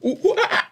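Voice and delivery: imitated podcast intro sound. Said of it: "the one that starts like".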